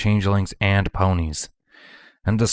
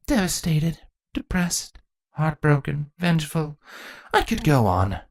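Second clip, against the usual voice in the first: dry list; 8 semitones higher than usual. A narrator's reading. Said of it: "Chrysalis said dryly".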